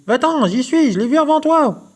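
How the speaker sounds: high, loud possessive cry; little mouse crying out to keep her place; shouting mouse. A narrator's reading. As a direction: yell it at the intruder, high and shrill-voiced. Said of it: "l'entend et crie".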